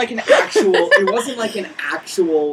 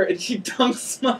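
normal talking voice

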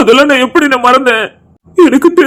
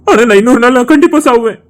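ordinary delivery

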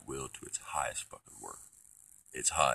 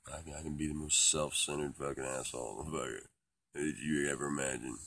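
ordinary talk, nothing marked

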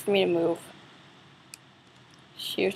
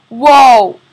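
very loud startled whoa; startled yell; 2 semitones higher, 18 dB louder than usual. Real, not acted. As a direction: shout in sudden shock. Sudden scare.

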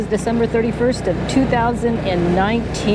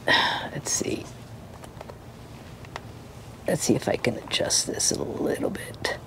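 whispering; 6 semitones lower, 11 dB quieter than usual. Real, not acted. Adjusting camera, mic close.

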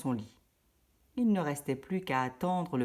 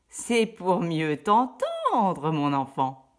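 sly wolf; 6 dB louder, much slower than usual. Acted. Le loup déguisé répond.

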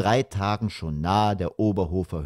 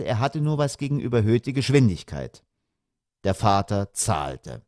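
ordinary delivery, nothing marked

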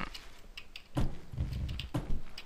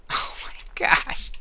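whispered; 6 dB quieter than usual. Real, not acted. Amazed after a mishap.

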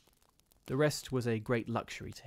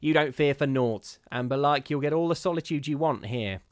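put-on higher, louder scornful old charwoman; the charwoman scoffing at ghosts, higher and louder; superior charwoman; 4 semitones higher, 7 dB louder than usual. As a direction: sniff down at the gentleman's fears. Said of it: "she said".

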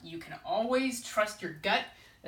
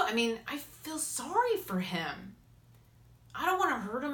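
ordinary talk, nothing marked

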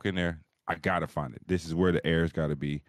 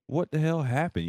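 baffled outburst; 9 semitones higher than usual. Retold or imitated. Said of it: "So I'm like".

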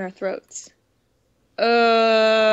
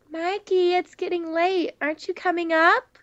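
lightly voiced girlfriend calling from the stairs, higher; Sarah calling; 7 semitones higher than usual. Girlfriend calls Mikey down from upstairs, higher voice. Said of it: "Her voice called from the stairs".

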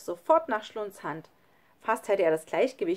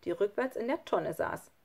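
ordinary delivery, nothing marked

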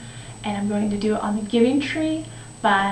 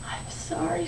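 very soft, higher apology; sorrowful; 4 semitones higher, 9 dB quieter than usual. Acted. The tree is sorry it has nothing, sorrowful. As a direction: apologise in a small, hushed voice.

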